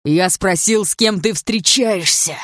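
громко и зло, растягивая слова; давить голосом, яростно требуя ответа; злое шипение. A narrator's reading. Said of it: "прошипел он". (злой, громкий нажим)

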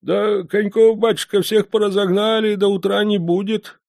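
put-on high, meek old man's voice; old postmaster. A narrator's reading. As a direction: answer like a frail old man.

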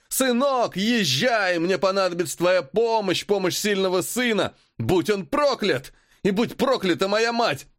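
very high raving madman; asylum patient. Acted. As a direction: scream curses, unhinged. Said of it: "безумный смех".

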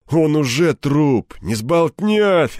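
slow, loud, high-pitched dismissal; callous mockery. A narrator's reading. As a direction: stretch it out with a sneer. Кирпич насмешливо списывает пленника. (врастяжку, с издёвкой)

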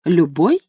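a questioning rise on «Любой». A narrator's reading. Lucinda queries her husband's claim, questioning rise on «Любой». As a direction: echo «Любой» with the tune lifting at the end. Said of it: "спросила Люсинда".

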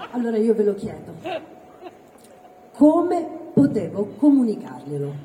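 ordinary talk

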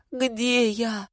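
high, plaintive question; narrated pitiful little plea. A narrator's reading. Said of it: "потом жалобно спросил".

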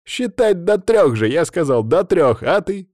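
high, loud mock complaint; indignant banter. A narrator's reading. Stas reproaching Katya over the countdown, indignant. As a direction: protest loudly, punching the count. Acted teasing quarrel between two friends.

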